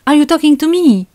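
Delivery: high, loud, startled-sounding question; feeble comeback. Acted. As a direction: squeak it out, nowhere near tough. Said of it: "ma réplique était à des années-lumière de celle de De Niro".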